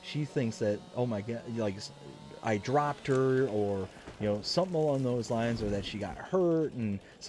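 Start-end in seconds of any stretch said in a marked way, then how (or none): none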